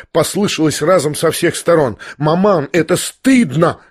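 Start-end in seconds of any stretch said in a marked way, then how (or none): none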